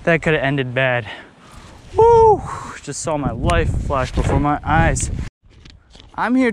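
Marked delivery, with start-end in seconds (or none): 1.9-2.4 high loud whoop; relieved whoop. Real, not acted. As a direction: whoop after a close call. Near miss.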